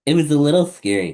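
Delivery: excited rush. Acted. Excited storytelling.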